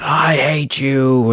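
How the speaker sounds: slow, drawn-out hateful line; bitter Anakin. Retold or imitated. Fan quoting Anakin's line to Obi-Wan, slow and bitter. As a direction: drag each word out with bitter hatred. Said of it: "Anakin replying with".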